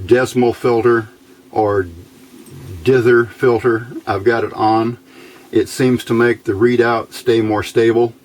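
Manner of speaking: normal talking voice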